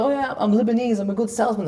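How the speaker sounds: high, mocking boast; mock a bragging Lebanese, high; lightly mocking high-pitched boaster. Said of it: "people say".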